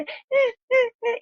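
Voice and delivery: high, hammed-up fake wailing; mother imitating her son's tantrum with mock sobs; mock crying. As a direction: pretend to bawl like a toddler. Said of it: "Me puse a llorar así de".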